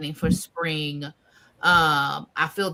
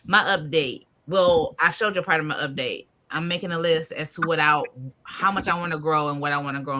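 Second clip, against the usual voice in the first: ordinary talk, nothing marked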